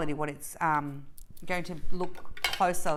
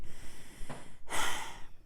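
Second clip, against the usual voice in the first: sigh; 8 dB quieter than usual. Real, not acted. Emotional thanks to viewers.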